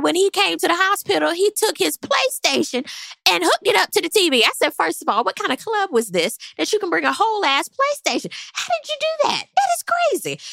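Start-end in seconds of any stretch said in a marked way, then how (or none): 4.7-10.4 builds from mild disbelief to much higher incredulity; rising disbelief. Retold or imitated. To herself about the PlayStation, rising disbelief. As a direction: start amused, climb to a high incredulous squeal. Retelling her own disbelief.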